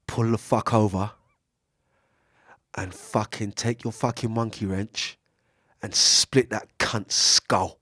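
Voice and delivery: low, slow, hint of menace. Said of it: "This is what your lower voice says".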